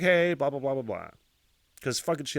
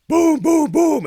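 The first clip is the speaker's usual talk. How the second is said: high, shouted booming knocks; big vocal banging effect; loud door-pounding imitation; 12 dB louder, much slower than usual.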